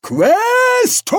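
very high, loud, drawn-out yell; furious shout. Acted. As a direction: roar the title in outrage. Archchancellor, red-faced, yells for bursar.

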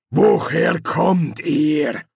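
slow, loud foreign guard; growling guard leader. A narrator's reading. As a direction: growl the question at intruders, word by word. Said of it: "Der Anführer knurrte eine Frage".

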